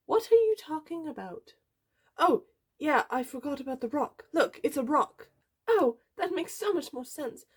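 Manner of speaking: high-pitched, animated teacher voice; squeaky character voice, turning mock-scolding; excitable science teacher. A narrator's reading. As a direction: play the teacher high and scatty.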